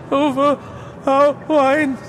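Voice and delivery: high, quavering voice of a broken man; Fortunato's sad voice. A narrator's reading. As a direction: laugh it off, pretending it's a joke. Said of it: "It was succeeded by a sad voice".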